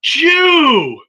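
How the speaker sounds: high, loud cry of alarm, acted out; mock shout. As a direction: shout it out like a shocked vendor. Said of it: "I swear he went".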